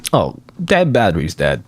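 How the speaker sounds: ordinary delivery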